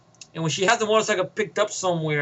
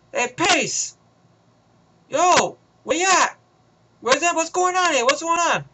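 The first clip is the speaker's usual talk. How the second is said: higher, slow worried radio call; radio henchman; 8 semitones higher than usual. Retold or imitated. Henchman on the radio calling Pace, worried. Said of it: "Time Warrington Scott is calling him".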